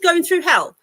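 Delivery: incredulous. Real, not acted. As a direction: scoff at the phrase. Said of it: "I just can't believe I'm reading this".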